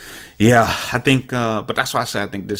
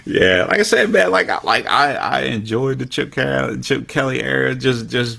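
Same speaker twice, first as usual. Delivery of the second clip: higher-pitched, still getting over a laugh; 8 semitones higher than usual. Real, not acted. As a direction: talk on as the laughter dies down. Recovering from a laughing fit.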